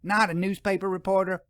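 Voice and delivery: lightly voiced doubtful storekeeper; suspicious shopkeeper. A narrator's reading. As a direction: shopkeeper pressing the professor.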